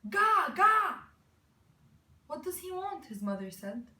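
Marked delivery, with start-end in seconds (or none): 0.1-1.0 high, loud infant scream; shrieky toddler cry, full volume; screamed baby babble. A narrator's reading. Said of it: "Raven Child screamed". 2.3-3.1 higher-pitched questioning mother; mother's puzzled question. A narrator's reading. Mother's line in a story.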